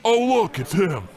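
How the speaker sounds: high, loud burst of alarm; sudden alarm. Acted. Bartender jolts as he points to the suspect, high and loud. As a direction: blurt it out as if caught lying.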